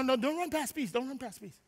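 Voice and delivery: ordinary talk, nothing marked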